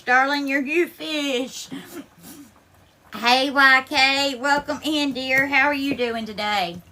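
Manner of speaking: ordinary delivery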